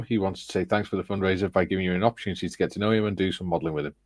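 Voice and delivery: fast read-aloud of a message, hurried along; speed reading. Real, not acted. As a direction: rattle through the announcement. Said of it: "speed reading is getting so much better".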